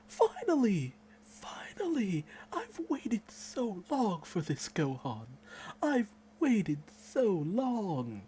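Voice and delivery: high, breathy cries of relief, with a hushed whisper in the middle; finally getting what you waited years for; overjoyed fan cry. Retold or imitated. Fan greeting Gohan's comeback, high overjoyed cries. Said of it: "I'm like".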